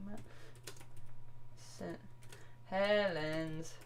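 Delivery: drawn-out quiet dictation while searching; slow, typing it in. Shown as a demonstration. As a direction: mumble each word as you type it. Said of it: "let's have a look".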